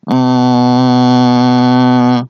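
one long, loud held note imitating a fart; long fart noise. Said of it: "然后一个长声". (模仿又长又响的屁声)

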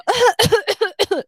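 loud, high put-on cough; theatrical coughing fit; exaggerated cough. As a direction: mime the coworker's fake cough. Coworker coughing the whole way up.

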